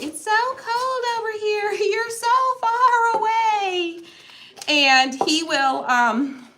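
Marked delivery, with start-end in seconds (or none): 0.0-4.0 high, drawn-out whine to her husband; pitiful high whine, slowing down; whiny complaint. Retold or imitated. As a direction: complain to him across the bed. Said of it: "And I'll be like".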